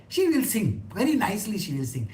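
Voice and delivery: normal talking voice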